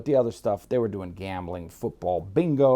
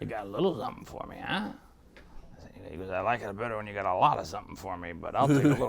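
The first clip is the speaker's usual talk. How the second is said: groggy voice; 2 semitones lower, 4 dB quieter than usual. Retold or imitated. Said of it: "Got that real groggy voice".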